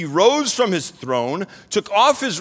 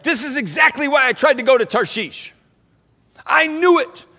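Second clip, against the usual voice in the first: annoyed Jonah; 7 semitones higher, 3 dB louder than usual. Retold or imitated. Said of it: "Jonah's like".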